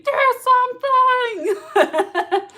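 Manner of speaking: laughing shout. Real, not acted.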